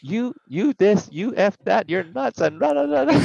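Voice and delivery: high, laughing string of curses; a cop swearing through laughter; laughing mock cursing. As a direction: curse him out while cracking up.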